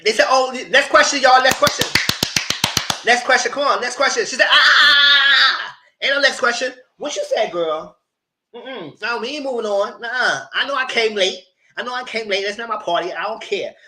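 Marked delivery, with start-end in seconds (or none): 0.3-4.2 loud, high, insistent calls; urgent deflecting. Retold or imitated. Group trying to move on to the next question, loud urging. Others hurrying past the argument. 4.5-5.8 long, high, loud "ah"; interrupting yell. Retold or imitated. Woman cutting off the calls for next question, drawn-out yell. Imitates her cutting them off. 6.0-12.4 retold protest. She refuses to move on.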